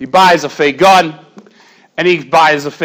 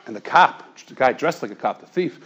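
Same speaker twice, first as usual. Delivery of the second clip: usual speaking voice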